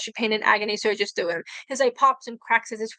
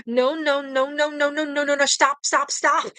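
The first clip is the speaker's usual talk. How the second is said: higher, frantic begging; terrified higher no and stop in a rush; panicked pleading; 4 semitones higher, slower than usual. Acted. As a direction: beg desperately for it to stop.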